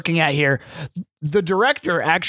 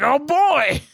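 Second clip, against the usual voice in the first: mock eureka; 7 semitones higher than usual. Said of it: "was just like".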